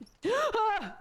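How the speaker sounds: sudden startled inhale; a quick shocked gasp, high and loud; sharp gasp. Real, not acted. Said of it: "I, I inhaled!".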